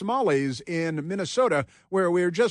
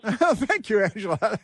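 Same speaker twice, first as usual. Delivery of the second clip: laughing thanks; 7 semitones higher, 3 dB louder, slower than usual. Real, not acted. Host laughs at caller's sign-off.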